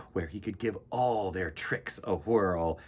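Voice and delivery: ordinary delivery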